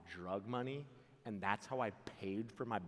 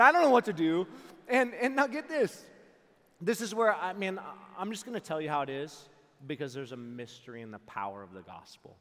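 normal talking voice

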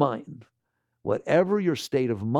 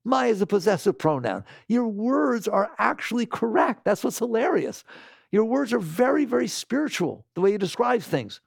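ordinary talk

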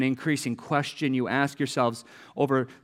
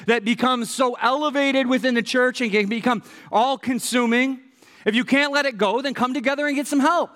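ordinary delivery

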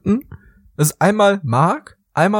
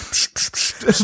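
mock shushing; 4 dB quieter, much slower than usual. Shushing the listeners.

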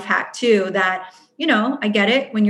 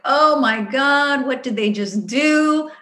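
slow, drawn-out mock outrage; acted outrage; 5 semitones higher, slower than usual. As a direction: stretch it out in higher-pitched disbelief. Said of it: "when I am just outraged".

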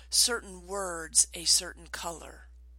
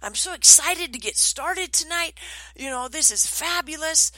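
higher, louder gushing audience welcome; bubbly retold opener; excited welcome; 8 semitones higher, 5 dB louder than usual. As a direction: re-enact an eager trainer greeting the crowd. Cheery opening to audience.

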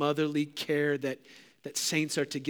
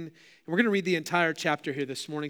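ordinary talk, nothing marked

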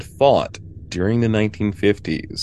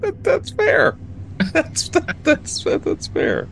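squeaky high voice. Acted. Squeaky stammering reply.

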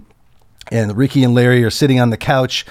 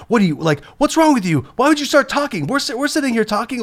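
much higher, mock-outraged Larry voice; imitating Larry berating; 11 semitones higher than usual. Larry berates the whispering cameraman, high mock outrage. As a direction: berate him like an indignant Larry. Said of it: "starts yelling at Ben".

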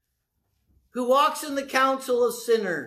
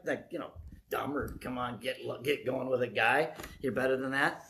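low, softer retold reply; naive fumbling explanation; 9 semitones lower, 8 dB quieter than usual. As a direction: fumble through it in a lowered voice. Retells his naive explanation to preacher.

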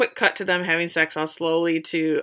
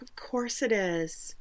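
soft, slow, breathy hush; hushed reassurance; 9 dB quieter, slower than usual. Retold or imitated. Claire soothing Eric that it is real, slow and hushed. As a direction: murmur it like a comforting secret.

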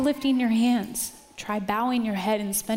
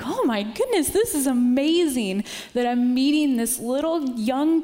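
amazed; 5 semitones higher, 5 dB louder than usual. Retold or imitated. Amazed at meeting the kid.

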